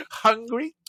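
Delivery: put-on high, teasing offer to feed the baby; a hint of playful nursing parent; mock offer. Joking about breastfeeding babies, high teasing voice.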